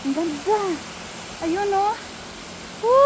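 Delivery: normal talking voice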